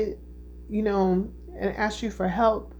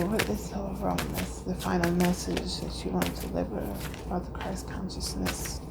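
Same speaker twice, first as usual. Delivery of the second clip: hushed question to the cards; 7 dB quieter than usual. Real, not acted. Asking the tarot cards quietly.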